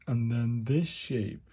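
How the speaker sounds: normal talking voice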